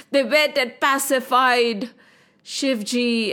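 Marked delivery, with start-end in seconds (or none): none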